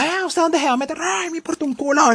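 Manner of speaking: voz aguda y desafiante de quien se cuela; rude pusher. Retold or imitated. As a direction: se cuela sin importarle nada. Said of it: "gente que se mete a la fuerza". (colado grosero con voz aguda)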